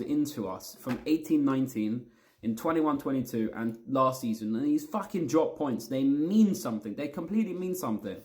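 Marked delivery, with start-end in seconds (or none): none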